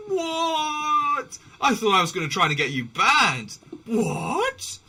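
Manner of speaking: high, loud, baffled what; shocked yell. Retold or imitated. Strangers baffled by slurring back at them, yelping. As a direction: cry out in disbelief.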